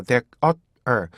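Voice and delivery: "that, uh, er" as three separate beats. Shown as a demonstration. Teacher splitting the word into three beats. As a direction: split "there" into "that, uh, er". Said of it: "두 번째 음절에서 입이 앞으로".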